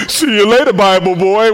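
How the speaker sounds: teasing taunt. Retold or imitated. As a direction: kids taunting a friend. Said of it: "And we would say".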